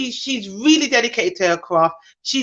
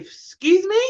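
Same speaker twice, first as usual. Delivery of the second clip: indignant excuse me; 7 semitones higher, slower than usual. Real, not acted. Indignant reaction to racism.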